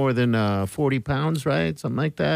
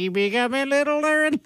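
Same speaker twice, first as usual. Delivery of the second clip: put-on high squeaky baby voice; 11 semitones higher than usual. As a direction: squeak it like a little kid. Joking about shrinking men.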